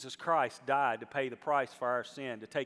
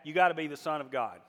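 usual speaking voice

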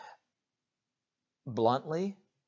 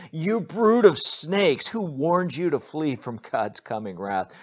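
booming high prophet's rebuke; preacher voicing John the Baptist, loud rebuke; 6 semitones higher, 10 dB louder than usual. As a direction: thunder it at the crowd like John.

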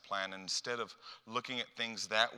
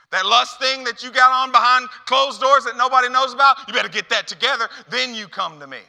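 loud, high, scolding rant; divisive, raised-voice condemnation; screaming, yelling; 12 semitones higher, 16 dB louder than usual. Acted. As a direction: shout the demands like a wrathful God. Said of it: "this isn't a screaming, yelling".

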